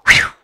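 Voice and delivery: quick loud whoosh; whooshing away. Acted. Gruffalo turned and fled, quick whoosh. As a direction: make a swift vocal whoosh.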